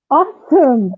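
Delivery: high, loud mock-cheer; retold sarcastic reaction, punched up; sarcastic dismay. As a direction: exclaim it as if it's just great. Sarcastic reaction to finding blood.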